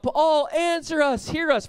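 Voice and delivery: high, drawn-out plea to Baal; crying out. Acted. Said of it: "they're crying out".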